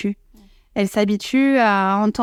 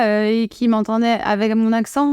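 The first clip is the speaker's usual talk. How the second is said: usual speaking voice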